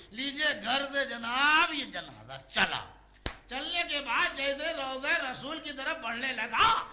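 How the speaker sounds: loud, high, dramatic narration; preaching at full force. Real, not acted. Preacher telling mourners of the blocked funeral, loud and dramatic. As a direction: declaim it to the mourners.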